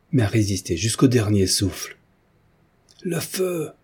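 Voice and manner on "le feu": high cry to incite a mob. A narrator's reading. Morozza urges the crowd to burn Hélène, crying out. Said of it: "cria Morozza".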